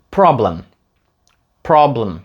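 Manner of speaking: "problem" as "prob-lm"; "problem" with blended final consonants; reduced PROBLEM. Shown as a demonstration. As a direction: stress prob and swallow the last vowel. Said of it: "останні звуки просто зливаються".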